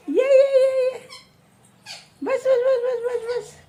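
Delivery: put-on high-pitched repeated calls to a toddler; quick, excited play chant; playful calling. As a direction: coax a small child along, voice way up. Calling child along in play.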